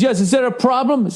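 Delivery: high, prim mock of the rude driver; snooty driver. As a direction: play the smug Jaguar driver asking. Rude driver voiced in story.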